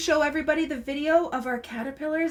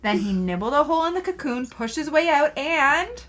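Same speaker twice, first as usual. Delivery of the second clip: lively storybook read, words drawn out; reading to children; 3 semitones higher than usual. Acted. Mother reading a caterpillar book to her child, lively storybook voice. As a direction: read it aloud to a small child.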